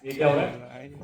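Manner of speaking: barely audible croak with no voice left; weak, soft, voiceless rasp; voice gone. Retold or imitated. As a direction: strain to speak through a lost voice. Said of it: "मेरी आवाज़ ही नहीं निकल रही।".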